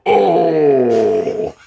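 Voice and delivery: long falling "oh" of a shocked crowd; crowd's shocked Oh. Retold or imitated. Rooftop party catching him in the act, mock shocked groan. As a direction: imitate a roomful of onlookers reacting. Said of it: "he hears a hundred people go".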